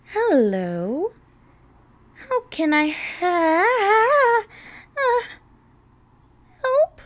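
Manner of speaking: loud, high, word stretched out and broken; drawn-out high trail into a stunned squeak; startled greeting. A narrator's reading. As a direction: greet cheerily, then falter in shock. Greets visitor, startled by the sight.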